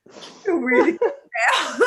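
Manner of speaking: quoting herself through real laughter; laughing. Retold or imitated. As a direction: laugh through the words, delighted. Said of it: "I was like".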